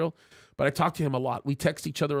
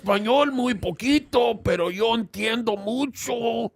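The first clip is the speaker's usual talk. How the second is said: playful Spanish with a put-on Mexican lilt, higher; mock Mexican accent; 5 semitones higher, 3 dB louder than usual. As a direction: ham up a Mexican accent, lightly. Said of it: "Although I could sound like a Mexican".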